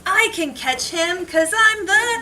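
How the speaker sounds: high, loud and stretched out on Gingerbread Man; triumphant shout. Acted. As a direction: belt it like a hero's catchphrase. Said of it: "I said as I ran".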